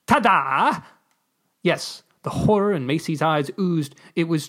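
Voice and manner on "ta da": showy, higher-pitched ta-da; exclaimed. A narrator's reading. Ambassador showing off a magic trick, exclaiming. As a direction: exclaim it like an amateur magician's reveal. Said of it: "The ambassador exclaimed".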